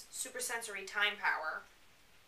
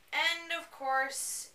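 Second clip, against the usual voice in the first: usual speaking voice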